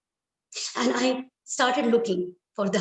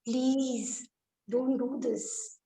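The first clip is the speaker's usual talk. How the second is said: soft plea; 3 semitones higher, 5 dB quieter, much slower than usual. A narrator's reading. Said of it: "she fell on her knees and she pleaded".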